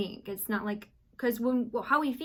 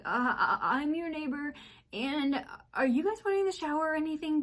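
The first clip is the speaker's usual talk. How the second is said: a hint of a panicked neighbor, higher and stammering; 7 semitones higher than usual. Frightened neighbor at the door asking about the shower, higher mock panic. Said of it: "He's, like, panic mode".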